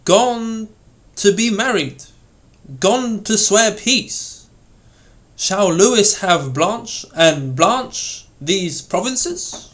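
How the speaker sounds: high, slow, indignant stress on "Gone" and "swear"; compound stress. Shown as a demonstration. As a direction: stretch "gone to swear peace" slowly, rising in disbelief. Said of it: "indicative of surprise, irony, and determination".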